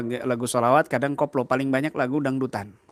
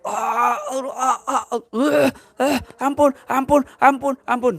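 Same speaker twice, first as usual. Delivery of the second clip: high, loud, pained cries of ah and ampun; screaming for mercy; 11 semitones higher, 4 dB louder, slower than usual. Retold or imitated. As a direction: scream out begging mercy in a strange voice. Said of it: "langsung teriak". (jeritan kesakitan minta ampun dengan suara tinggi)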